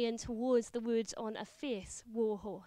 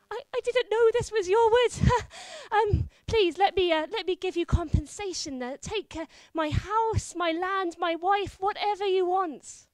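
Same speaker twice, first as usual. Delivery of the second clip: high, loud, stammering panic; cowardly husband begging the stranger knight; terrified babbling; 5 semitones higher, 7 dB louder than usual. Acted. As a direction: grovel in a rush of fear. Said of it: "he's so terrified".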